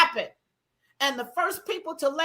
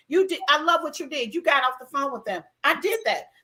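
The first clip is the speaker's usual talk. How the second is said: usual speaking voice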